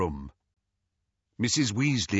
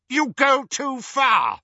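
high, slow, outraged shout; angry shout; 4 dB louder, slower than usual. A narrator's reading. Minister rises to shout Harry down, furious. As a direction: roar it, stretching each word. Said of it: "shouted Scrimger".

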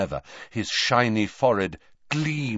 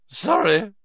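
with a hiccup; 13 semitones higher, 8 dB louder than usual. A narrator's reading. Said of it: "said Slughorn with a hiccup".

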